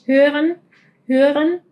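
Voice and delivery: "hören" with the r almost gone; vocalized German r; soft R in hören. Shown as a demonstration. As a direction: keep the r in "hören" subtle. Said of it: "la R casi suena como mucho más sutil".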